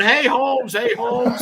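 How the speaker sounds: hollering from the porch; mock yelling. Man on the burning porch yelling for the firefighter, mock yelling. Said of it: "this dude's yelling".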